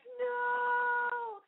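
long, very high wailed word; mock wail. Tourist answering the driver's warning, mock wail. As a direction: draw it out in hammed-up dismay. Acting out her dismayed response.

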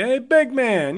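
bright, high friendly hail; mock friendly stranger's hello; cheery greeting. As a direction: call out to a jogger like a friendly neighbor.

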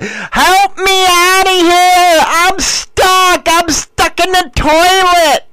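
high, slow, stretched-out pleading; panicked cry for help. Pretend. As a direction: yell for help like you're stuck. Imagined girlfriend stuck in toilet.

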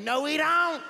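high put-on voice of a stubborn mother; mock drunk mom. A neighborhood mom insists her son is fine, in a mock high voice. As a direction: play the boy's mother denying it flatly. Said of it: "And she's like".

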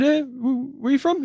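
high, hammed-up British pub talk; mock UK accent. Host voicing himself inviting the alien to the pub, mock British. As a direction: play a chummy Brit inviting him out. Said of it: "our various UK accents".

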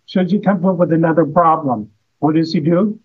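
usual speaking voice

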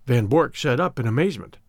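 ordinary delivery, nothing marked

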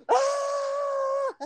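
long, very high-pitched loud scream; mock scream. Retelling her answer to the nurse, high scream. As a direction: shriek it out as a comic re-enactment. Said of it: "I would scream".

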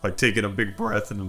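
usual speaking voice

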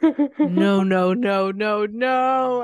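loud, laughing protest of no. Real, not acted. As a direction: shout no at the titles. Reacting to smut titles.

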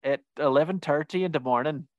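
badly faked Irish accent; attempted Irish accent. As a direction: have a clumsy go at an Irish lilt. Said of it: "That's not how Irish people sound".

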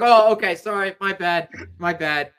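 retold backing down, starting high and loud; loud startled apology that settles; hasty apology. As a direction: imitate someone suddenly apologetic, then easing off. Other man backing down, retold.

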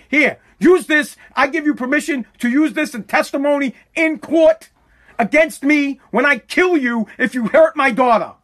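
loud, high-pitched real rage; yelling threats at the killer; furious rant at the parents; raging. Real, not acted. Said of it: "I don't mean to yell and rage".

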